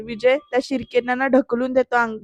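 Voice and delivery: ordinary delivery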